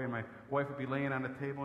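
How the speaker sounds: usual speaking voice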